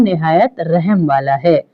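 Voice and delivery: ordinary talk, nothing marked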